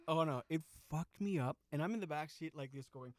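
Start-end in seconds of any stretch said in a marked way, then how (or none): none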